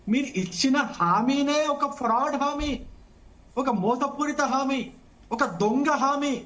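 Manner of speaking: slow, each accusation hammered; slow scornful emphasis. Real, not acted. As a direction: spell out the charges one by one with contempt. Debater slowly denouncing rival's promise. (నెమ్మదిగా, ఒత్తిగా ఎగతాళితో ఆరోపణ)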